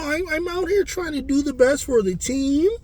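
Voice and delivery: high-pitched imitation of a sweet little girl; imitating sweet young player. Player telling interviewers she's doing her best for the team, high sweet imitation. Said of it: "when she's talking".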